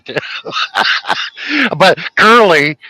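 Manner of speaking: usual speaking voice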